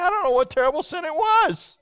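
high, exasperated protest of innocence; hammed-up indignant grumble; grumbling protest. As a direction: complain loudly up high, acting the wronged man. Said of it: "he was grumbling because he said".

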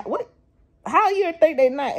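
high incredulous question; can't believe what she thinks; high disbelieving question to Carmen about her kids. Acted.

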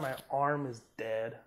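ordinary delivery

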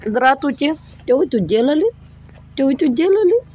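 put-on high sing-song baby talk to kittens; cooing baby talk. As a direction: coo at the kittens. (сюсюканье)

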